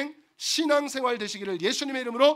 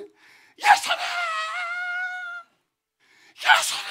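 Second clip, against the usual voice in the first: crying out; slower than usual. Acted. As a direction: cry out to be heard over the crowd. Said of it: "더 크게 소리".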